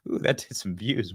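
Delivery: ordinary talk, nothing marked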